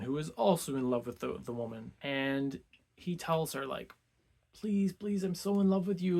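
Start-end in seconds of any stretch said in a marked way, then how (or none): 4.5-6.2 higher-pitched, mock-earnest begging; imitating a pleading lover; lovesick pleading. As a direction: lightly act out a besotted suitor's plea. Lovesick suitor pleads with married woman.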